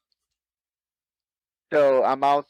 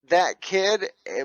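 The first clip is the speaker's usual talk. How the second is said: ordinary talk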